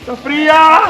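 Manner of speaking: high shout from icy water; cold shock shout. Real, not acted. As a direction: yell at the freezing plunge. Jumps into cold pool. (grito por el agua helada)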